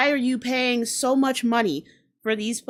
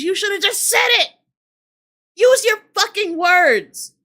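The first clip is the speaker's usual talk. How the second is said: higher and louder, furious yelling; pissed rant; 4 semitones higher, 3 dB louder than usual. Real, not acted. Vlogger ranting at a bored concertgoer, furious shouting. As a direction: lose it at the crowd. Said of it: "I'm pissed".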